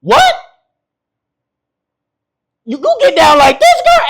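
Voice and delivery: mock bedroom shock climbing to a laughing shout; shocked man in bedroom. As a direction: act out being caught off guard and thrilled. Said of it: "She have you in that bedroom like".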